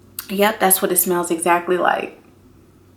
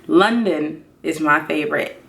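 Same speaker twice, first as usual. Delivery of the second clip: ordinary talk, nothing marked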